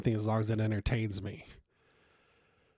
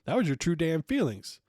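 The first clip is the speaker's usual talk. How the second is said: indignant retort; 6 semitones higher, 4 dB louder than usual. Retold or imitated. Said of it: "I'm going".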